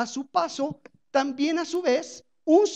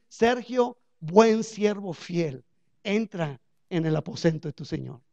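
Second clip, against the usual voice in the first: gentle welcome from God; 8 semitones lower, 4 dB quieter than usual. Pretend. God's hoped-for words to him.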